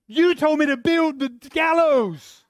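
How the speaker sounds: slow, very high and loud protest; indignant Haman. Retold or imitated. Haman yelling back at his friends, slow and shrill. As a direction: whine it out in outrage. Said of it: "I'm going".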